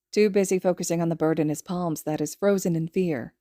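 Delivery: ordinary delivery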